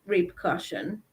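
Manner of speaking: ordinary talk, nothing marked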